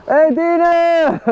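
high, slow friendly call. Real, not acted. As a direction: hail a friend from the bike. Calling out to a friend.

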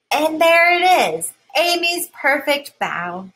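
delighted reveal. A narrator's reading. Triumph at the perfect bun.

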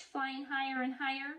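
plain reading voice

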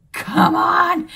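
loud, high mock exasperation; exasperated. Real, not acted. Mom reacting to son, loud exasperation. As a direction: throw up your hands. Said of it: "mock exasperation".

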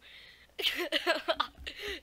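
big high burst of laughter; loud laugh. Real, not acted. Vlogger bursting out laughing while retelling the story. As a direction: crack up at the memory.

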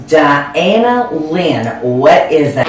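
very slow, drawn-out scolding; scolding mom. Retold or imitated. Mom discovering the ham, scolding. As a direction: stretch out the name in mock sternness.

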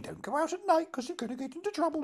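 high, fast nanny voice; fussing nanny. Acted. Nanny warning a charge about going out at night, high-pitched fussing. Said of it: "And it is a nanny".

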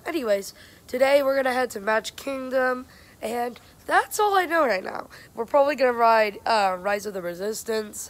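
ordinary talk, nothing marked

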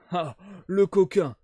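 slow, loud, delighted cry; delighted exclamation. Acted. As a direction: relish catching the trick. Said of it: "s'écria Jones d'une voix enchantée".

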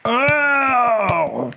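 long, very high "ow"; high wail as the weight lands; drawn-out cartoon howl of pain; scream of pain. A narrator's reading. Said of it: "The crocodile screamed in pain".

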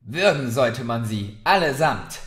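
fed-up barmaid snapping aloud; furious outburst. Acted. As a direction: spit it out at the whole tavern. Barmaid cursing the rowdy guests.

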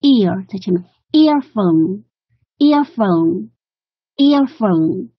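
earphone said slowly with the stress on ear. Shown as a demonstration. Teacher correcting the stress, slowly punching ear in earphone. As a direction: hit ear in earphone, then let phone fall. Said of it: "这个重音应该是ear在前面".

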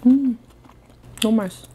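mumbled between bites; talking while chewing. Real, not acted. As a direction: mouth full of food. Seen 0: Cheeks full, jaw chewing through the words; R frames plainly talking.

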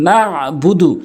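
"না'বুদু" with a pressed ayn in the throat; আইনে চাপ. Shown as a demonstration. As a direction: squeeze the throat after না in "না'বুদু". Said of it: "না'বুদু বলতে হবে". ("না'বুদু"-তে আইন চাপ দিয়ে বলা)

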